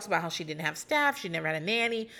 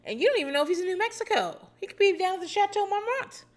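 rising excitement; 12 semitones higher than usual. Real, not acted. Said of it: "I can't even say it".

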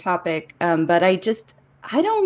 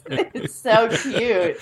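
laughing; 6 semitones higher, much slower than usual. Real, not acted. Delighted by the dancing comic.

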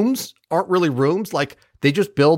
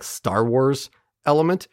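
usual speaking voice

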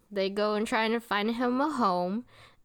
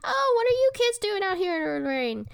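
high-pitched mock woman's voice; imitating village woman; 11 semitones higher than usual. Recapping the villager meeting the kids, high imitation. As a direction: put on a high, fussy character voice.

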